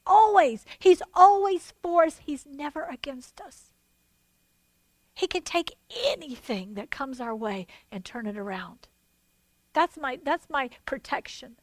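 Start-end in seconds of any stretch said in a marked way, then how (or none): 2.2-8.9 sudden hush. Real, not acted. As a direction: drop to a soft, low voice. Sudden drop after shouting.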